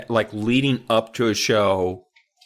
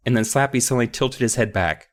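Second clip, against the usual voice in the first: ordinary delivery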